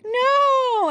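put-on high, loud child's protesting no; child's wail. Son refusing a new food, high loud wailing no. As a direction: cry out like a toddler refusing food. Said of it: "he's like".

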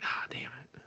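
very soft, breath-only mock curse; whispered frustration. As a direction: curse under your breath like a let-down viewer. Viewer's let-down reaction, retold.

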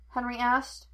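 ordinary delivery

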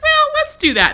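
high, brighter nurse voice imitated; sweet nurse. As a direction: play the kind nurse offering help.

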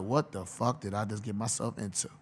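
Bill Belichick. Retold or imitated. Head coach warning a new signing, low and quiet. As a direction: drop low and quiet like a strict head coach laying down terms. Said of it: "Bill Belichick looking at him like".

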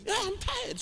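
high, soft, plaintive imitation; put-on high voice, softly protesting tiredness; tired sick man. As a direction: mimic a weary patient in a thin high voice.

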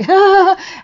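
squeaky, uneasy little laugh; awkward giggle pitched high; nervous high laugh. Retold or imitated. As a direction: laugh nervously while squashed in a hug. Awkward moment during the hug.

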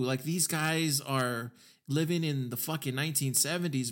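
normal talking voice

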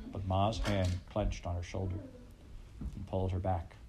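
usual speaking voice

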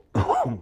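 a short chesty cough; coughing fit. Real, not acted. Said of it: "excuse me".

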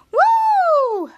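long, very high, loud woo; excited whoop. Real, not acted. Host celebrating the finished recap, whooping. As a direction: let out a held, falling whoop.

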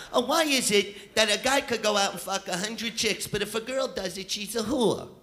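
a lightly voiced indignant woman; mock complaining woman. Women complaining to friends, lightly voiced whine. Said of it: "You'll hear them have conversations like".

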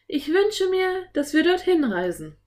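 put-on high, held, incantatory girl's voice; young girl's wish. A narrator's reading. Anne wishing them into the picture, high solemn chant. Girl speaks the magic wish.

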